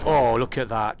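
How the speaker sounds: sudden delighted exclamation; excited. Real, not acted. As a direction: burst out, thrilled with the kill. Excited reaction to a kill.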